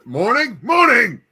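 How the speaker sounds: loud, high sing-song morning; goldfish greeting. Pretend. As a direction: chirp it like a goldfish meeting you anew. Mimicking forgetful goldfish greeting.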